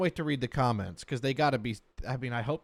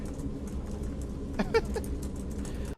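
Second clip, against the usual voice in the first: laughing; 6 dB quieter than usual. Real, not acted. Reacting to deposition.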